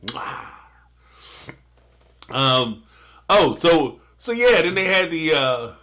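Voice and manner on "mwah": soft pretend kiss, "mwah"; playful kiss sound. Host pretends to kiss the like button, playful soft smooch. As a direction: plant a soft mock smooch.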